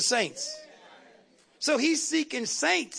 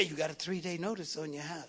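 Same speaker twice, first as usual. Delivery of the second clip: low, soft menacing growl; a hint of a growling devil; growled devil threat; 6 semitones lower, 10 dB quieter than usual. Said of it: "he growls and say".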